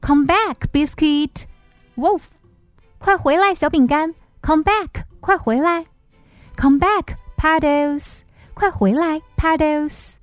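high calling voice; calling after puppies. Acted. Girl calls dogs back.

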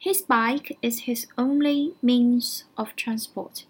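slow, careful English read-out; slow teacher model reading; slow model sentence. Shown as a demonstration. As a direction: spell it out slowly for learners. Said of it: "这句话怎么说".

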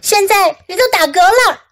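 mild high-pitched yell; mom yelling. A narrator's reading. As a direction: call it out high to the child. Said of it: "妈妈大喊道".

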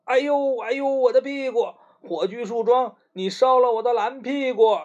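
hammed-up yelp over a burnt bottom; storybook yelp of pain. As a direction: Ice Melon crying out at the Torchwood, mock pain. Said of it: "大声叫".

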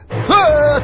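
sudden, very loud, high terrified yell; full-throated yelp of fright; startled scream. A narrator's reading. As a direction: shriek in shock. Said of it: "お父さん驚いて".

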